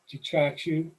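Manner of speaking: ordinary talk, nothing marked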